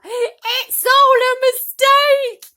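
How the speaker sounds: very high, loud, slow wailing cry; crying protest. Acted. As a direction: wail in disbelief. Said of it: "cried Gertrude".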